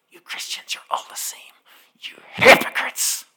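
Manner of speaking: whispered with scorn, mock hiss; contemptuous whisper. The car park man sneering at the preacher, whispered. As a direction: lean in and whisper the insult. Man's accusation retold.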